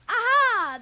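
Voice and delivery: loud, high magician's flourish; big triumphant magician reveal; showy aha. Acted. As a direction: reveal it like a stage trick.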